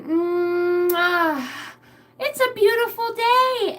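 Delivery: high, loud, drawn-out delight; playful exultation. Pretend. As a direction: build from a hum to a big sing-out. Mock revelation playing with daughter.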